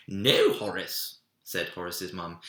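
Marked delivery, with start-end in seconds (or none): 0.1-1.2 loud, slow firm "No, Horace"; a mother stopping him sharply; firm mum. Acted. Mum forbids the bite.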